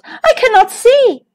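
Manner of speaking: a high, desperate cry for help. Acted. The prince falling from the tower, a high cry for help. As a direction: cry out as you fall into the thorns. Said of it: "he cried".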